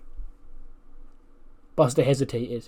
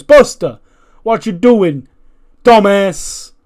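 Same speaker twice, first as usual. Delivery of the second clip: much higher and louder, an exasperated yell; MVP yelling at Buster; 6 semitones higher, 11 dB louder, slower than usual. Retold or imitated. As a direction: bellow it into the microphone at him. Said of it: "He goes".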